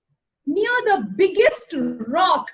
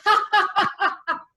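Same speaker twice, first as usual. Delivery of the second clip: higher, hammed-up villain laugh; storybook tiger's gloating ha ha ha; mocking laughter; 5 semitones higher, faster than usual. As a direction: let the tiger roar with scornful laughter. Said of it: "roared in laughter".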